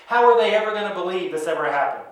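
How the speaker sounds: usual speaking voice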